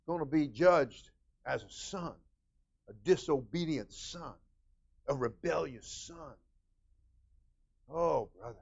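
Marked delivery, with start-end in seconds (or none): none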